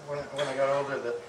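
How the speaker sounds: ordinary talk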